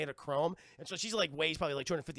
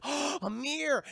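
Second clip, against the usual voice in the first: mock alarm; 9 semitones higher, 5 dB louder than usual. Heroine voiced in recap.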